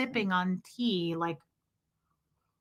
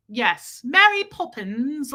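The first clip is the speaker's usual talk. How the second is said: slow, higher prim British lilt; Mary Poppins accent; 4 semitones higher, 4 dB louder, slower than usual. Retold or imitated. Streamer imitating Stella in a mock British lilt. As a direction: play it like a proper British nanny. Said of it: "Stella sounds like Mary Poppins".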